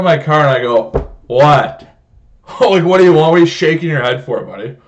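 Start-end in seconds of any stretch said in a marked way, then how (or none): 1.3-4.8 loud, indignant call-out; confrontational challenge. Retold or imitated. Driver challenging manager shaking his head, loud confrontation. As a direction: raise the voice and pitch, demand an answer.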